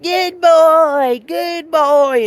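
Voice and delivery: high, loud, gushing praise; hyper good boy. Retold or imitated. Mocking knuckleheads who hype the dog, squealing praise. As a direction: squeal it like an overexcited owner. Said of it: "one of those knuckleheads that's just like".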